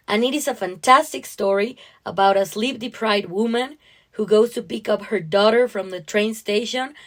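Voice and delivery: plain reading voice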